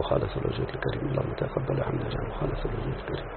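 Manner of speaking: a hushed, fast whispered supplication; whispered prayer before the lesson. Real, not acted. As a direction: whisper the prayer quickly under the breath.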